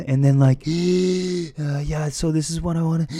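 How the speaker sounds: huge high, loud sucked-in gasps between words; gasping while talking. Retold or imitated. Exhausted candidate trying to answer a debriefer, gasping between words. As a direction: imitate talking through desperate gulps of air. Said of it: "So I'm talking like that".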